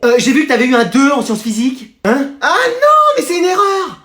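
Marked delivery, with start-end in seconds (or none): 2.4-4.0 panicked protest. Acted. Kid caught with bad mark.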